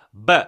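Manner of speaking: "B" as a short hard "buh"; struck B sound. Shown as a demonstration. Teacher drilling a sound Korean lacks, punched "B". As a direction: hit "B" as one quick burst. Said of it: "때려봐요".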